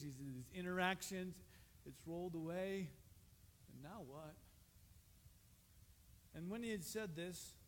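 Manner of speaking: ordinary talk, nothing marked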